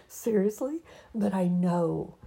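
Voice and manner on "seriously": rising disbelief on seriously; incredulous seriously. Real, not acted. To herself about writing from heartbreak, incredulous. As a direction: ask it in open disbelief. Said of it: "it's like".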